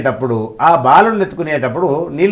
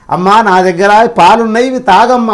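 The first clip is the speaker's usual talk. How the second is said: put-on higher, louder little boy offering milk; child voice; 5 semitones higher, 5 dB louder than usual. Young boy offers his milk bottle to the goddess, as a child. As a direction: sweetly urge mother to drink.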